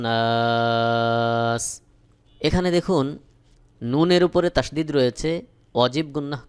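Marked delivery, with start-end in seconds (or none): 0.0-1.6 long drawn-out chanted নাস; slow tajweed chant; recitation. Acted. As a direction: hold it like a reciter. Recites verse ending.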